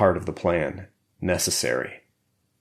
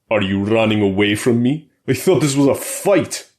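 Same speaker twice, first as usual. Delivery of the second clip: put-on louder, higher Russian-accented holler; hollered taunt; 4 semitones higher, 6 dB louder than usual. A narrator's reading. Boris jeering at Colt before the crowd, loud holler. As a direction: bellow it at the fleeing opponent. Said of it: "the bear hollered".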